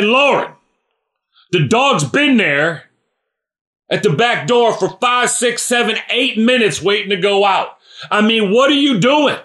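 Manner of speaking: high, loud exasperated yelling; harsh scolding. Shown as a demonstration. Father yelling down the stairs at his daughter, harsh and exasperated. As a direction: snap at her down the stairs. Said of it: "One is speaking in a harsh way".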